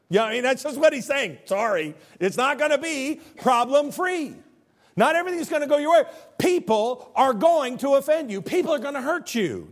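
high, loud, emphatic preacher; impassioned preaching. Acted. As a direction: drive the warning home forcefully. Preaches a hard truth.

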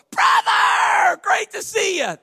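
loud, high, booming pastor welcome; a pastor loudly greeting someone whose name he forgot; fake hearty greeting. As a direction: overdo the cheer to hide a blank. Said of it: "if I ever go".

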